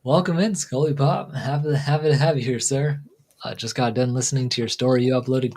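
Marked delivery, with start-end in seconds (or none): 0.0-3.0 higher, upbeat welcome to a viewer; streamer greeting. Acted. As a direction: hype up the viewer's arrival.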